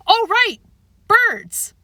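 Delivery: high, loud, startled realization; sudden realization. Acted. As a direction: blurt it out as it hits you. Howie realizes with gasp.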